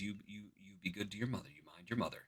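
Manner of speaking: very soft, fast hushed farewell; tender father. Retold or imitated. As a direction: whisper a gentle goodbye to a child. Father saying goodbye to son.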